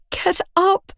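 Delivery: high, desperate tearful plea; begging. Acted. Girlfriend begging her drugged boyfriend to stand, desperate. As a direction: beg him to get up through tears. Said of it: "I begged".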